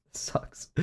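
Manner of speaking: unvoiced, very soft dismay; breathy whispered grumble; whispered complaint. Real, not acted. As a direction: whisper it in disappointment. Reacts to bad drawing.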